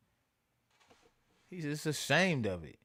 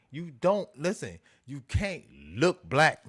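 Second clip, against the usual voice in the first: louder, pressing the point hard; emphatic raised voice; 7 dB louder than usual. Real, not acted. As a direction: push back, voice rising in protest. Heated rant about denying blackness.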